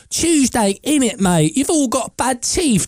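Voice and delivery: high-pitched mock British accent; bad British accent. Americans on TikTok mocking Brits, bad British accent. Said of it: "doing a bad accent".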